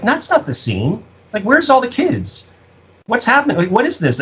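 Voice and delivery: high-pitched incredulous questioning; retold bewilderment. Writer challenging the crew over the footage, bewildered and high.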